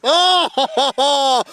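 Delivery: loud, high, laughing whoops of joy; roaring celebration in the commentary box; excited yelling. Real, not acted. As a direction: erupt as the wicket falls, yelling. Wicket falls, commentator erupts.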